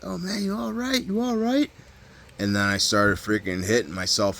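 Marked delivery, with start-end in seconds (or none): 0.0-1.7 high-pitched falsetto bystander voice; mimicked concerned onlookers. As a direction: put on a very high, mock-concerned voice. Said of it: "they're like".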